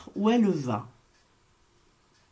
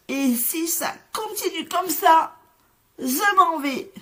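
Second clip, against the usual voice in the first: high, loud little voice, fed up; upset chick; 9 semitones higher, 4 dB louder than usual. Acted. Chick tells the whole farmyard he has had enough, high and loud. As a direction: protest like a hurt little chick.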